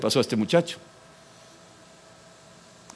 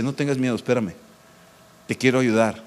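tender Christ; 4 semitones lower, 4 dB quieter, faster than usual. Acted. Christ comforting the fearful listener.

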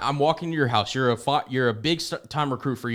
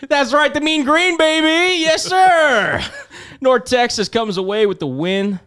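high, loud whoop of team pride; exuberant cheer; 13 semitones higher, 7 dB louder, slower than usual. Real, not acted. Fan bragging about the Mean Green, loud gleeful yell. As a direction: crow it out, stretching the last word.

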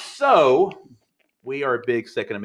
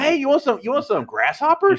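high, eager hawker offering food; hammed-up high offering; mock John the Baptist; 14 semitones higher, 4 dB louder than usual. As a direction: push grasshoppers on a guest.

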